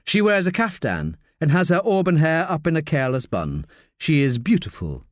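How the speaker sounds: usual speaking voice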